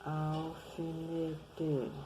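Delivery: "Alfinete" slow, L as U, "aufinete"; slow blended reading. Shown as a demonstration. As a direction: read "Alfinete" slowly as "aufinete". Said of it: "Lembra de novo?". (leitura lenta, "Alfinete" como "aufinete")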